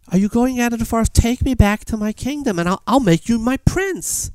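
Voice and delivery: high, urgent storybook princess; princess pleading. Retold or imitated. Princess begs the young man for rescue, urgent. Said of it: "the princess says".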